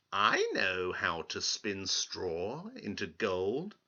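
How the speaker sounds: slow, odd little man voice; strange little man. Acted. Little man greets the crying girl, slow and odd. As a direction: greet her slyly and slowly.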